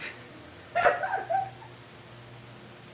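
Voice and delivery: high, wheezy burst of laughter; laughing. Real, not acted. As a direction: crack up in a long high laugh. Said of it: "I'm laughing".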